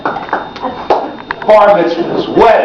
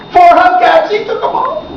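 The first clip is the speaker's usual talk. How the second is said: much higher, building exclamation; punchline outrage; 13 semitones higher than usual. Retold or imitated. Comic telling how uncle took all hubcaps, high squeal.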